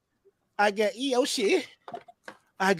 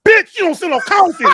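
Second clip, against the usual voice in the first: much higher, louder indignant yell; retold outraged shout; 12 semitones higher, 10 dB louder than usual. Stunned customer told there's no coffee, yelling in outrage. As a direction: blow up in disbelief.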